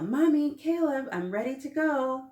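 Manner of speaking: put-on high, loud eager child yell; excited kid shouting for mom and brother. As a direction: call out to the whole house, high and loud. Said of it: "I yell".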